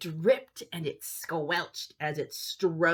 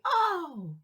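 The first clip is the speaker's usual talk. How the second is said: usual speaking voice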